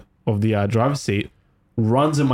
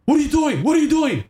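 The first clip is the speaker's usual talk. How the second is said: imitating a startled cop shouting; alarmed shout; 13 semitones higher than usual. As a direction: bark it in alarm. Cop startled by driver's move.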